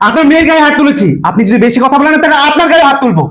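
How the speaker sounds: high, heated defiance; defiant threat. Acted. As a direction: face him down angrily. Husband threatens father-in-law.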